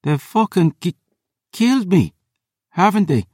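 high, halting voice in pain; wounded woman. Acted. Wounded Phoebe realises she is dying, high and pained. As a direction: stammer it out, mortally hurt.

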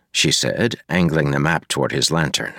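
usual speaking voice

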